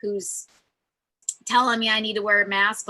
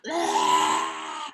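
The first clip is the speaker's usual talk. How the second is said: a much lower, shouted ah; releasing shout. Shown as a demonstration. Telling tense listeners to get it out, a deep shouted ah. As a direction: push the tension out in one deep yell. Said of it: "get it out".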